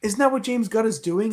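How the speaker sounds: normal talking voice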